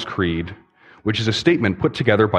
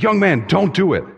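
higher, urgent plea to the jumper; pleading old man; 9 semitones higher than usual. Acted.

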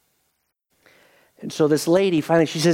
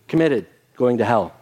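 ordinary delivery

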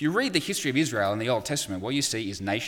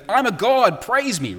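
grand boast, much higher and louder; Loki boasting; 11 semitones higher, 8 dB louder, slower than usual. Retold or imitated. Loki demanding praise from the Hulk, pompous boast. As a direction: proclaim yourself a god. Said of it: "Loki goes".

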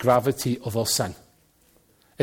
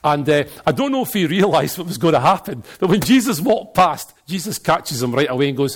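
ordinary talk

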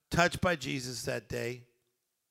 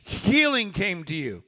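loud, high-pitched, driving sermon delivery; rousing preacher building question on question; full-force preaching; 6 semitones higher, 6 dB louder than usual. Real, not acted. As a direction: preach it out to the whole congregation, voice raised.

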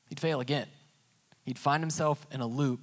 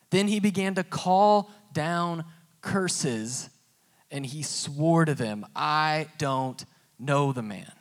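slow and louder, higher build; slowed dramatic reading; 4 semitones higher, 5 dB louder, slower than usual. Acted. Preacher slowly dramatizing Peter's sworn denial, louder. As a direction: draw out the curse and the denial.

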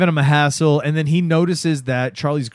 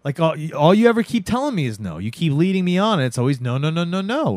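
ordinary talk, nothing marked

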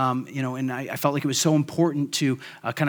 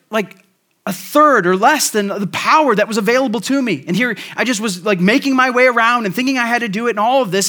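louder and higher, building energy; animated preacher climax; excited; 6 semitones higher, 7 dB louder than usual. Real, not acted. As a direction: pour out the realization with gusto. Excited payoff of yard illustration.

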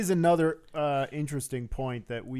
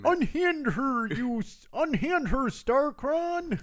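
high, slow Kermit-like puppet cry; hammy stage actor; 12 semitones higher, slower than usual. Acted. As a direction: ham it up like a puppet hero. Muppet voice in crossover joke.

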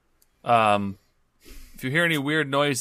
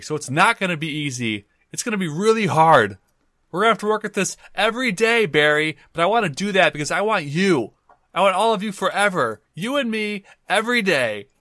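higher and louder, swelling romantic vow; impassioned declaration; 9 semitones higher, 5 dB louder than usual. Acted. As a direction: pour your heart out like a soap opera lover. Player's hammed-up love declaration to Barry.

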